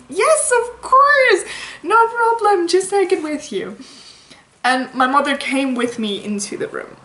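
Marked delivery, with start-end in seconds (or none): 0.1-3.8 sweet nurse voice. Retold or imitated. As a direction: soothe a scared patient sweetly. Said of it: "she was like".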